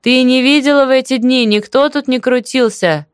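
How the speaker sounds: mild insistent shout; shout into ear. A narrator's reading. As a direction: make a deaf neighbour hear you. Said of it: "крикнула она в самое ухо".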